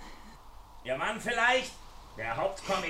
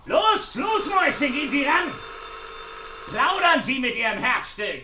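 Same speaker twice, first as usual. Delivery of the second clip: loud jeering call, pitched up high; mocking shout; 5 semitones higher, 6 dB louder than usual. Villain jeering at trapped woman, loud mocking shout. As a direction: bark it at her through the door.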